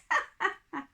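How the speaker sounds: put-on light girlish laugh. A narrator's reading. Ellen laughing at the king's bird-nest beard. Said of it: "Ellen laughed".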